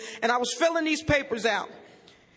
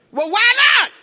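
loud, much higher "why not"; indignant yell, much higher; defiant shout; 10 semitones higher, 9 dB louder than usual. Retold or imitated. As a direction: shout the retort back at the form. Defiant answer to consulate form.

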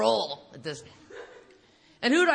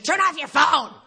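much higher, much louder snap at someone; mock scolding shout; 8 semitones higher, 10 dB louder than usual. Scolding someone in the audience over a phone, a mock shout. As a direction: bark it at the whole room. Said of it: "I was gonna give someone shit".

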